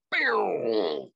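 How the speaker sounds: long falling boom; music drop imitation. Dancer recalling the music coming on, imitated boom.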